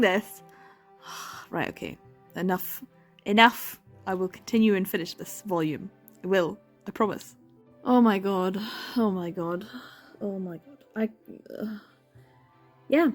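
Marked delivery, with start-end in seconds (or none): none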